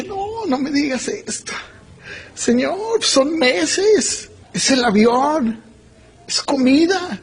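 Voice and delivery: high-pitched mock pleading with God; pleading protest. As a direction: act out bargaining against a hard order. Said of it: "tratando de convencer a Dios". (súplica de protesta, fingida y aguda)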